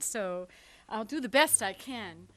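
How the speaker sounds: ordinary talk, nothing marked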